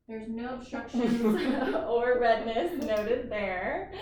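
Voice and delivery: giggling through the words; laughing. Real, not acted. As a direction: laugh while you talk. Examiner laughs mid-sentence.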